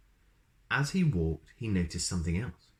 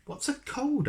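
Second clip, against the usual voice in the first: very high, puzzled little voice; high squeak. A narrator's reading. Mister Sneeze asking the wizard, in a very high squeaky voice. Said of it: "asked Mister Sneeze".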